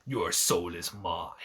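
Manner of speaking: low, slow video game villain; deep, drawn-out evil threat; Shang Tsung. Acted. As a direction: intone it like a menacing fighting-game sorcerer. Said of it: "reminds me of Shang Tsung from".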